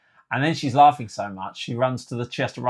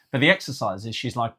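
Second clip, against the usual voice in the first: usual speaking voice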